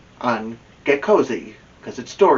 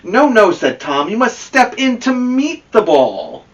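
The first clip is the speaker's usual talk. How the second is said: higher, louder, insistent "No, no"; coach correcting; 10 semitones higher, 5 dB louder than usual. Acted. Tom tells Amelia Bedelia how to swing, higher and insistent. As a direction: set her straight on batting.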